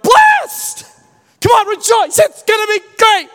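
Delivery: a tactless friend hyping up a mourner; loud fake-cheerful hype; mock overeager cheer.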